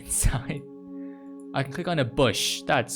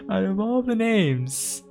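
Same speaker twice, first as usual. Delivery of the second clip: high, slow, through leftover laughter; amused, voice lifted by laughing; laughing in disbelief; 8 semitones higher, 5 dB louder, slower than usual. Real, not acted. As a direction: crack up at a silly name. Cracks up at the cat's name.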